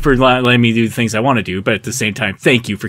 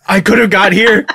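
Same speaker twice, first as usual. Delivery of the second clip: baffled; 9 semitones higher, slower than usual. Acted. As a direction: sputter in disbelief. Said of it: "Hank just looks baffled".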